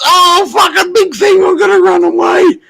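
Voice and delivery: high, squeaky little creature voice, quick; a panicky small lizard fleeing; voicing the lizard as it scurries off, squeaky and fast. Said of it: "he goes".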